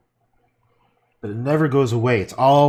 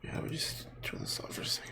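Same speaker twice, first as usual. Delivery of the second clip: whispering; 17 dB quieter, faster than usual. Real, not acted. Turning recording off.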